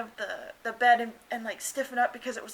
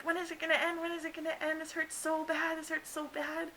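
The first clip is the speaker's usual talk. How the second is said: pained pleading; 6 semitones higher, 4 dB quieter than usual. Retold or imitated. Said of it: "I thought I was yelling, but my mom said I wasn't".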